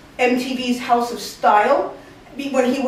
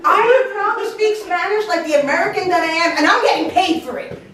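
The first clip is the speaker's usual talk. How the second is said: high, proud declaration; defiant retort; 7 semitones higher than usual. Retold or imitated. Daughter answers mocking family, proud defiant retort. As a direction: stand your ground with raised pitch.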